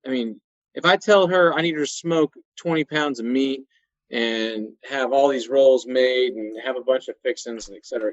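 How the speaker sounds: normal talking voice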